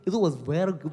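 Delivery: "It was very good" with rolled, slurred English sounds; mock fluent, slurred English; rolling English. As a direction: imitate kids over-rolling "very good". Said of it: "애들 발음이".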